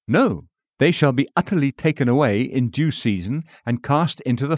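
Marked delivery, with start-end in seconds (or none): none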